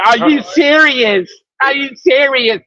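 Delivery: slow, drawn-out mock indignation; every syllable pronounced. Woman told to get out the car, every syllable pronounced. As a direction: imitate her stretching each syllable of the question. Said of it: "they pronounce every syllable".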